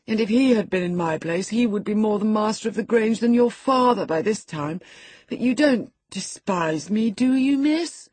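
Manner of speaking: petulant young boy, lightly voiced; whiny boy pleading for sympathy; sickly boy voice. Linton complains to Catherine.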